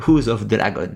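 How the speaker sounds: "House of Dragon" said with a heavy English accent. Real, not acted. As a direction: say the title the English way, clumsily. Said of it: "Désolé pour mon accent anglais terrible". (prononcé avec un accent anglais maladroit)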